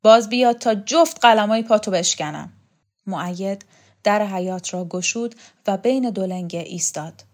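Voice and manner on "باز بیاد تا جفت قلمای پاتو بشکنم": loud, angry threat; raised voice, full of menace; yelled threat. A narrator's reading. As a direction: yell a warning after him. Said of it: "فریاد کشید".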